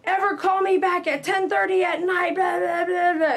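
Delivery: high, loud mock rant trailing into blah blah; angry caller ranting at the groomer, high and loud; retold cussing out. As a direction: act out the furious caller's tirade. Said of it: "and she cussed at me".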